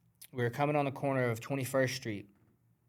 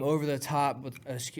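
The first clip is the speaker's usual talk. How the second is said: ordinary talk, nothing marked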